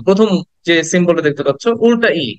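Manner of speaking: ordinary talk, nothing marked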